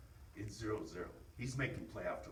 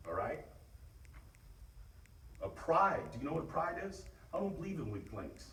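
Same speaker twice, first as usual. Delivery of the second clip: firm, rallying coach talk; rally the team before the game; pep talk; 6 semitones higher, 4 dB louder than usual. Real, not acted.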